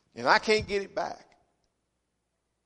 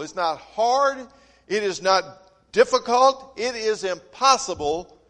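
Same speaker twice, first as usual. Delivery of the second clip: louder, building insistence; firm preaching; 2 semitones higher, 5 dB louder than usual. Acted. Pastor reading scripture to the congregation, insistent preaching. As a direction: drive the verse home.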